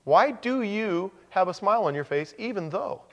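high, puzzled mock question; a lightly voiced bewildered stranger; incredulous outsider. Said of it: "somebody will be looking at us and saying".

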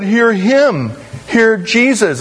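high-pitched, with HIM drawn out; stress on HIM. Acted. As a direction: quote it high, landing on "him". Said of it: "the emphasis is on the second word".

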